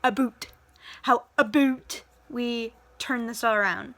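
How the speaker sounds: a boot, a boat stretched into "a boot" in a mock Canadian accent. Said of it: "I don't sit there and say".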